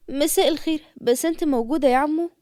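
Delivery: usual speaking voice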